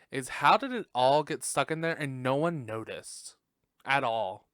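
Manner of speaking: ordinary delivery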